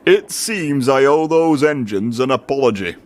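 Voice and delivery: a lightly voiced stern boss catching the culprit; a hint of a stern official; lightly voiced stern controller; Fat Controller.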